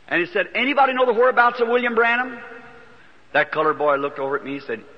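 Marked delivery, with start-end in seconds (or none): 0.5-2.4 calling out. Retold or imitated. Announcer calling out over microphone.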